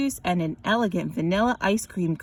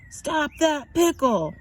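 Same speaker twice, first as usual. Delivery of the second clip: slow, somewhat higher chugging call; a lightly voiced sandwich calls out mid-run; sandwich shouting; 5 semitones higher, slower than usual. A narrator's reading. The sandwich calls out while chugging after the pickle. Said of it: "it shouted".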